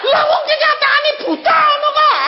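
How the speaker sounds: high, shouted mock protest; loud retort. Guide arguing back to God that he's blind, high exasperated shout. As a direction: argue back at full pitch, exasperated. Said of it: "Aku bilang gitu".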